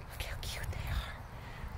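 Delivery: slow soft whisper. Real, not acted. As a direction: breathe it out slowly, hushed. Whispering near baby animals.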